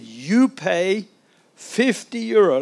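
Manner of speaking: imitated French-accented policeman, drawn-out demand. Big policeman demanding money from a traveller, French accent. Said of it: "he speaks in a kind of French accent".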